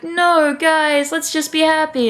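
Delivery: high whiny peacemaker; mock pleading. Spello begging the quarrelling family, mock pleading. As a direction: wail the plea in a high put-on voice.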